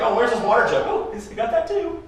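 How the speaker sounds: high, gloating mock voice; taunting. David taunting Abner about the water jug, high mocking voice. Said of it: "he sort of taunts him".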